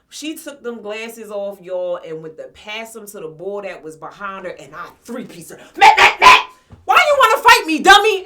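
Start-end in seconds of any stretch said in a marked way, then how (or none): 5.8-8.2 high, very loud furious yell. Retold or imitated. Shouting at the rival while punching her, furious yell. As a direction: scream it while swinging.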